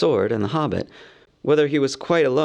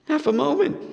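higher, hurried hobbit; flustered hobbit. A narrator's reading. Bilbo stalling against Gollum's riddle, flustered. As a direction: stall for time. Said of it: "cried Bilbo".

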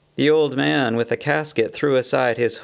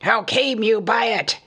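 higher, rising rage; raging shout; 9 semitones higher than usual. A narrator's reading. Thorin demands how they got the stone, enraged. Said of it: "shouted Thorin in gathering rage".

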